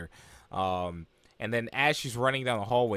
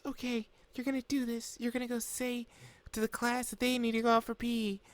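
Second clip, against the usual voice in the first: high, soft girlish put-on voice; imitated timid girl talking herself into it; anxious self pep talk; 13 semitones higher, 3 dB quieter than usual. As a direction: psych yourself up quietly, high-pitched.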